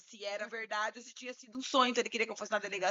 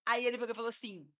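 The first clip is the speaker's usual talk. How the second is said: ordinary delivery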